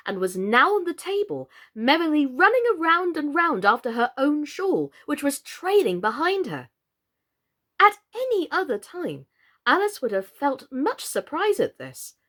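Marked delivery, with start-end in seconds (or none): none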